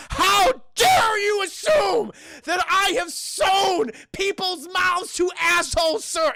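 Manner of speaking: high, loud, slow indignant shout; the doctor hams up his offence, shouting high and slow; mock outrage. Doctor shouts at the detectives in hammed-up outrage.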